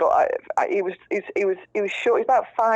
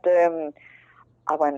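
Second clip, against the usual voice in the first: ordinary talk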